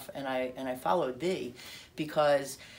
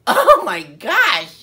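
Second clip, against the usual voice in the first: very loud, high, drawn-out wail; exasperated outburst; 8 semitones higher, 19 dB louder, much slower than usual. Retold or imitated. Reader finds the listener snoring, exasperated outburst. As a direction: blow up in slow, loud exasperation.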